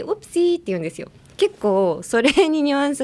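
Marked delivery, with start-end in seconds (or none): 0.0-0.6 high, lilting whoopsie; playful oops. Acted. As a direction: toss it off as a cute little slip. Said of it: "みたいな感じで".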